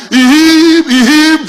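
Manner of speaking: high, loud stuck first syllable; stammering. Acted. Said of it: "My friend happened to be a stammerer".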